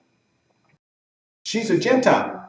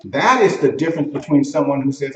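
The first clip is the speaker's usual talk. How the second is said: normal talking voice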